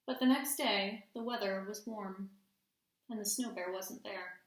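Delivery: storytime read-aloud with hushed suspense; gentle picture-book narration; reading to children. A narrator's reading. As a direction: read it like bedtime story time for the class. Teacher reads story aloud.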